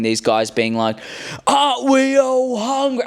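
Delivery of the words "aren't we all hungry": higher, slow, put-on persuasive voice; Jod impression. Jod manipulating the hungry pirates, high, slow and oily. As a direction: coax the crew like a smooth manipulator. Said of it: "I can do impressions".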